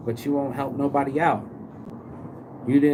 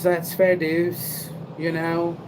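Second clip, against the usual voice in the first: higher, slow, matter-of-fact granny voice; stoic grandma; 6 semitones higher, slower than usual. Retold or imitated. Vlogger imitating a friend's tough grandma, slow and higher. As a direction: shrug it off like a tough old lady. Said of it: "she was of the other attitude".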